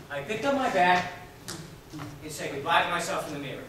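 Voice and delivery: normal talking voice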